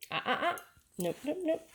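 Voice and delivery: high sing-song no-no at the puppy; warning uh-uh. Real, not acted. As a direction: halt her before she jumps. Stopping the puppy.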